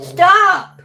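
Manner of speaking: high, loud yell of stop; real loud yell at the barking dog; sharp yell. Real, not acted.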